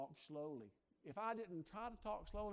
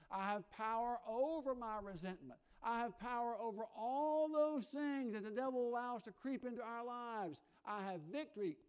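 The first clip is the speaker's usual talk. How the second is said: louder preacher's call, building to a cry; full-force preaching; 2 semitones higher, 5 dB louder than usual. Acted. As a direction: drive the congregation to break every chain.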